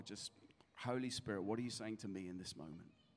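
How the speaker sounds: soft, low closing prayer; quiet prayer over worship music. Real, not acted. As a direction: lead the room gently into prayer.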